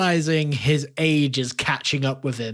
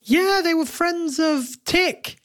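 Finn's voice; 3 dB louder than usual. Acted. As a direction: answer in the big tree's character voice. Player voices Finn.

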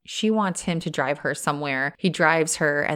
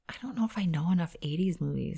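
whispered aside; 8 dB quieter, faster than usual. Real, not acted. Aside muttered to herself.

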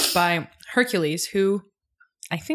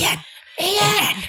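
yell-whisper a name across the yard; 13 semitones higher than usual. Retold or imitated. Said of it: "She's whisper yelling".

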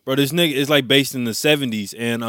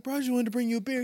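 high, fast offhand mock voice; casual beer guy; 11 semitones higher, 8 dB quieter, faster than usual. Retelling to friends, the beer guy explains, fast high mock voice. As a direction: toss it off lightly, pitched up. Retold scene.

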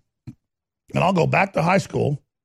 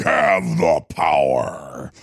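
deep voice; much slower than usual. Retold or imitated. Catchphrase, said low.

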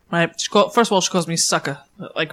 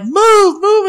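shouting; 12 semitones higher, 4 dB louder than usual. Retold or imitated. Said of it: "and I'm like".

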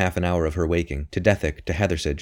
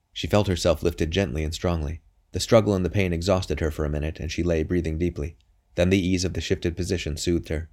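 plain reading voice

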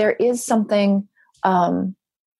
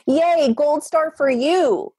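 high, drawn-out gold-star cheer; a playful "yay" sung out high and slow; cheering praise; 7 semitones higher, much slower than usual. Acted. Host rewarding listeners for practicing empathy, cheering praise.

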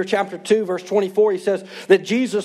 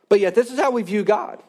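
normal talking voice

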